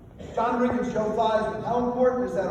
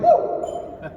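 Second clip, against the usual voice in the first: startled yelp; 4 dB louder than usual. Retold or imitated. Falling into grave.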